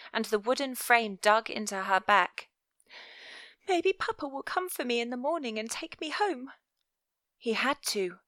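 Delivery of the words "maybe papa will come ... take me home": put-on a small girl wishing for home; young girl voice. Viola hoping aloud.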